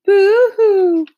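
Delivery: hammed-up high loud fake sob; a mocking wail, pitched high; mock boo-hoo. Mocking Bill's grief with a loud fake boo-hoo. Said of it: "laugh at and imitate later".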